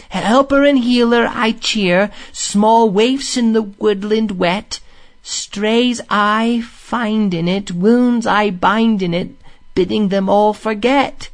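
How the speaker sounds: high, slow, lingering recitation; incantatory verse recital. A narrator's reading. Rat passes the fading song to Mole, incantatory. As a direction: recite it tenderly, spellbound, line by line.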